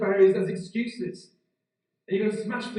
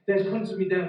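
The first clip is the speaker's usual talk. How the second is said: ordinary talk, nothing marked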